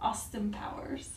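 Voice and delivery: whispered, slow guess. Real, not acted. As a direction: whisper it to yourself. Murmured guess, unvoiced.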